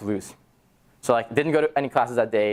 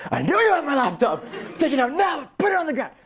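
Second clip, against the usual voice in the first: shouting demand. Retold or imitated. Confronting the laptop thief.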